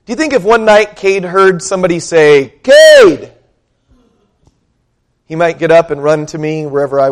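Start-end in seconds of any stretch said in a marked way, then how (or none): 2.6-3.3 a far-off voice calling his name. Pretend. Unseen voice calling the sleeping boy, loud and higher. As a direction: call the boy's name out of the dark.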